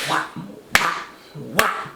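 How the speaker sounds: breathy whap sound effects; voiced blunt blows; imitated whacks. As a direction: mimic a skull being struck. Tomahawk blows in dream.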